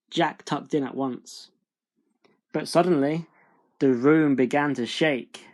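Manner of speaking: usual speaking voice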